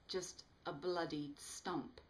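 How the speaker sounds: usual speaking voice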